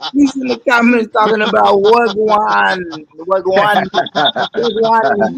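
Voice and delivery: hammed-up mock Jamaican patois; fake Jamaican accent. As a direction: mimic a fake Jamaican commenter. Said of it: "your best Jamaican accent".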